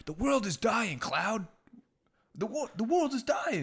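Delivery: urgent plea to Cloud, half acted; mock dramatic warning; game character line.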